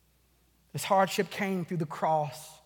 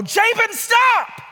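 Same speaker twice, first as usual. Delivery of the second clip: loud, high full-force shout; yelled warning; 12 dB louder, much slower than usual. Retold or imitated. Parent to child running in front of a truck, yelling. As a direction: scream it out to halt someone in danger. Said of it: "And so I yelled out".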